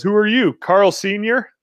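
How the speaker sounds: ordinary talk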